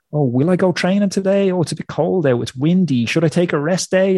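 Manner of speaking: high, pleading moan about skipping training; whiny inner voice. Retold or imitated. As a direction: whine like a lazy excuse-maker asking for a day off. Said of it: "little inner bitch voice that's like".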